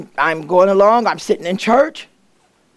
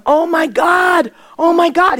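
amazed exclamation; 9 semitones higher, slower than usual. Retold or imitated. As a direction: gasp it out in disbelief. Doctor amazed at the scan.

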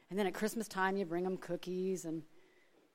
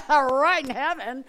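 slow, louder, higher line through laughter; laughing punchline; 11 semitones higher, 15 dB louder, slower than usual. Real, not acted. Answering people who guess she drives a Mercedes, laughing. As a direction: deliver the comeback while laughing.

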